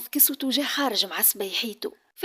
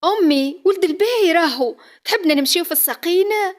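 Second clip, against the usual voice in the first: indignant protest; 7 semitones higher, 9 dB louder than usual. Acted. Daughter protests to mother.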